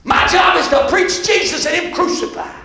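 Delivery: high, loud pulpit shouting; full-force preaching. Real, not acted. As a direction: belt it out to the congregation.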